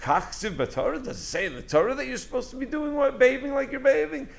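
pointed question climbing high; embarrassing challenge. Acted. Son showing up his father, a high-pitched challenging question. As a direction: challenge him, pitch rising as the question runs on. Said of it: "you're embarrassing him when you say".

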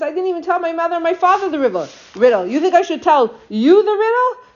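high-pitched mock disbelief; incredulous retort. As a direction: toss it back as an indignant question. Husband refuses his pleading wife.

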